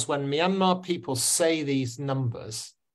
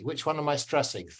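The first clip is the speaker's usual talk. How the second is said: ordinary talk, nothing marked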